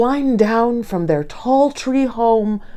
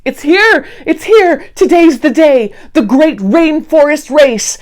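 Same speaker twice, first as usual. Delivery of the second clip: higher, louder, bursting with joy; excited Hank; 7 semitones higher, 5 dB louder than usual. Acted. Hank tells Dee race day has come, thrilled. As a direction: cheer that the big day is finally here.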